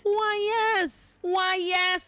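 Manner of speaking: high, prissy "why, yes"; high and loud mock-polite reply; mock prim knight. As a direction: play him as a smug, dainty gentleman. Voicing Cole answering, mocking him.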